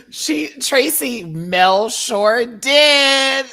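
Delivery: high, loud, slow laughing through the words; helpless laughter. Real, not acted. Mocking the terrible script, laughing high, loud and slow. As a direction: barely get it out for laughing.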